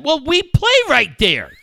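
high, loud imitated protest; indignant kid retort. Dad voicing his son objecting to a mom, loud mock indignation.

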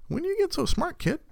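very high, amazed praise; astonished squeal. Retold or imitated. Father amazed at his son naming shapes. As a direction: marvel at the kid. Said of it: "I'm like".

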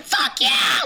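very high, triumphant yell; mock screaming. Friends cheering after the slap in the bar, screaming. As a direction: whoop it at the top of the voice range. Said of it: "screaming into this bar".

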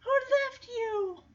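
a dognapper sweet-talking a puppy; fake nicey voice. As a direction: coo at the puppy to lure it in. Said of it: "trying to be all nicey".

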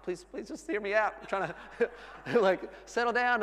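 high-pitched mock pleading, breaking into a laugh; pleading dad. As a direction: beg crying kids to hear you out. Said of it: "they both start crying. I'm like".

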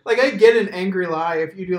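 usual speaking voice

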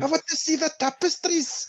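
squeaky mock Scottish accent, quoting the film; bad Scottish accent. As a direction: ham up a terrible Scottish accent. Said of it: "That's Ford's Scottish accent".

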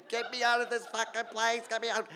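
high frantic cry to be let out; trapped in the machine, pleading high and frantic; panicked yelling. Retold or imitated. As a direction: yell it up high, trapped and desperate. Trapped, banging on the door.

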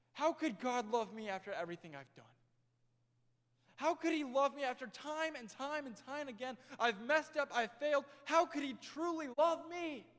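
put-on a teen begging to understand grace; doubting teenager.

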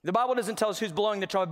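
normal talking voice

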